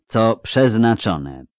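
an emperor's slow, measured verdict; drawled out. Acted. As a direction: spell out each word coldly. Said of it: "wycedził".

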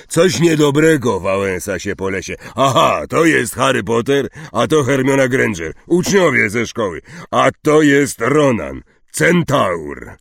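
big booming talk; hearty Hagrid. Acted. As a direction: introduce everyone noisily and cheerily.

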